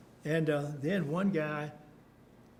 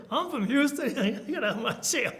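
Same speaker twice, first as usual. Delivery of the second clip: high, loud Southern comeback through laughter; raised, laughing take on a Southern accent; mock Southern accent; 8 semitones higher, 5 dB louder than usual. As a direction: imitate a Southern retort, laughing as you go. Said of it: "with his southern accent".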